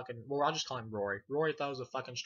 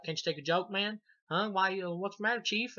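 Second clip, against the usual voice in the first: higher-pitched needling questions, imitating; mock taunting; 7 semitones higher, 3 dB louder than usual. Eddie taunts Tommy with the mask, high mock needling. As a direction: goad him in a put-on high voice. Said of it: "he's like".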